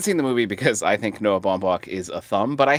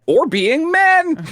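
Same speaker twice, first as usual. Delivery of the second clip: high slow voice; 6 dB louder, much slower than usual. Acted. Joke punchline.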